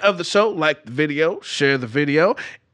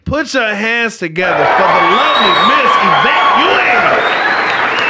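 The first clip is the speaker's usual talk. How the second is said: big welcoming call-out; announcer shout; 4 dB louder than usual. Acted. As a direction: hype the crowd for the guest. Host introducing guest.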